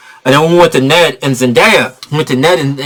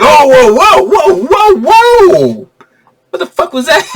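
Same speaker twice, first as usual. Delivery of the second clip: startled whoa; 12 semitones higher, slower than usual. Retold or imitated. Sandman startled by magic.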